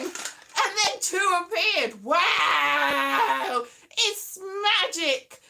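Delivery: loud, drawn-out wonder; hammed-up awe, loud and stretched; mock amazement. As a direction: proclaim it slowly like a magic trick. Mock amazement retelling special effect.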